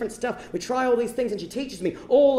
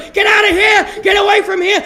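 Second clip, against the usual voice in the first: high, loud panicked yelling; panicked shout; 11 semitones higher, 13 dB louder than usual. Retold or imitated. Teen yelling at his girlfriend to flee as parents arrive, shouting. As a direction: scream her out of the room in a panic. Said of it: "and I go".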